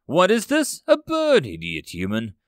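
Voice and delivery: Titan jeering in fury at the dying soldier; angry growl. Acted. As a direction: snarl it with contempt, peaking on the bird. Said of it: "The Titan growled".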